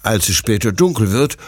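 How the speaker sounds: usual speaking voice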